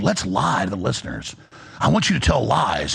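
low, sinister mock voice; mock villain. Host mocks the claim that he asked a guest to lie, low sinister put-on voice. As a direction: play yourself as a cartoon liar, deep and sly. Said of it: "I came on and said".

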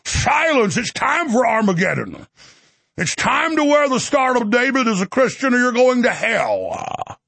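hammed-up hellfire sermon; mock-thundering televangelist declamation; affected preacher voice. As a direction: command the flock like a pompous pastor. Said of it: "Why don't you affect that voice".

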